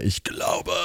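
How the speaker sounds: soft, voiceless imitation of a cry; hushed mock cry. Father straining to believe, hushed mock cry. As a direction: cry it out under your breath. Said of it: "schrie der Vater des Kindes".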